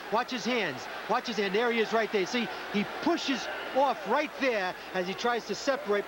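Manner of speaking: usual speaking voice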